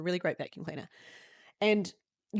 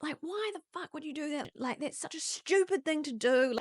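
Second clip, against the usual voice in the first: mock berating parent; 9 semitones higher than usual. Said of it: "I would have been screamed at".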